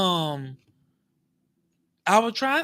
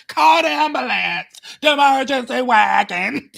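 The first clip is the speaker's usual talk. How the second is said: mock call for help; 5 semitones higher, 7 dB louder than usual. Pretend victim calling for help.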